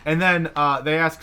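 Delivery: ordinary talk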